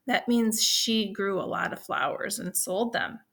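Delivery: ordinary delivery, nothing marked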